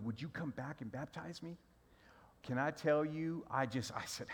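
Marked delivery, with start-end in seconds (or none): 0.0-1.6 whispered, holding back tears; a soft, unvoiced request; hushed plea. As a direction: ask it in a near whisper, choked up. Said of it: "And he said".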